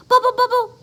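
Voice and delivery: high, loud startled bubble. Acted. Froggy caught chanting by the principal, high. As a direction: freeze mid-chant.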